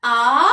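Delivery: loud, drawn-out "A" standing alone; "A" held long and strong for pupils; single beaten syllable. Shown as a demonstration. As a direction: punch out "A" as one clapped beat. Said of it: "et je frappe".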